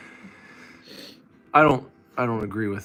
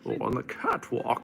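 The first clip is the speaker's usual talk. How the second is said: Bane voice. Acted. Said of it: "Stop turning into Bane when you do it".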